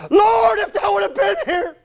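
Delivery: high, strained wail of grief and protest; loud, drawn-out, high-pitched cry of protest; anguished outcry. Retold or imitated. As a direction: cry out to God in bitter anguish. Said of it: "do you know what my response was".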